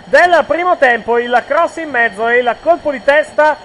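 screaming goal call at full voice; breathless italian goal commentary. Real, not acted. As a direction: yell the goal, high and loud. Live goal just scored.

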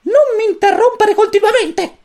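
very high, loud stern squeak; stern chick. A narrator's reading. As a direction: scold the interrupting boy like a tiny commander. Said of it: "esclamò con severità". (il pulcino rimprovera Gino, acuto e severo)

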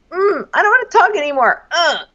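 high, petulant imitation of him; mock sulking. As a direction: whine it out and end on a disgusted grunt. Mocking his sulky refusal.

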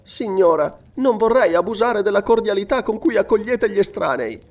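put-on high-pitched polite young voice; deferential girl. A narrator's reading. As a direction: demur politely in a high girl's voice.

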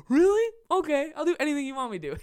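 high-pitched, smitten squeak, hammed-up; mock gullible delight. Customer falling for the stripper's fake affection, mock gullible delight. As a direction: go high like a smitten, eager fool.

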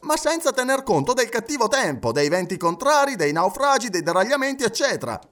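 high-pitched, animated list of objections; agitated objector. Acted. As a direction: pile up the objections excitedly. (obiezione agitata)

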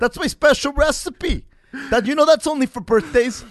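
loud, high mock-indignant mother; mock mom. Joking as mom guarding her recipe, loud mock indignation.

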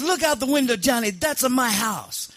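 high-pitched mock Sicilian accent; Sicilian mob boss. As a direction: show off like a hammed-up Sicilian don. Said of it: "Sicilian mob boss once".

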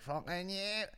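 high-pitched mock street lad; smeck parody. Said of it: "that's how you parody it".

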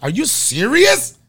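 high, loud, drawn-out question. Acted. Passenger repeating himself because she didn't hear, loudly appalled. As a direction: project it, sliding way up in pitch. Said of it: "She didn't hear me".

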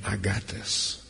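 soft, hushed reassurance, fading to a whisper; a whispered promise that all is well; tender whisper. Acted. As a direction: soothe him gently, almost breathing it. Jesus' compassion voiced again.

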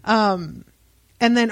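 ordinary talk, nothing marked

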